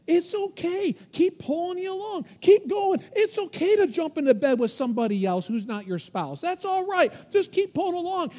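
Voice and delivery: high, loud coaxing voice of the world; the world tempting. Acted. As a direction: egg them on, high and loud. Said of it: "See, the world says".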